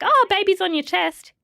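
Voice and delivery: chirpy orders from the staff; bossy midwife. Retold or imitated. Midwife telling new mum to latch baby, chirpy and brisk.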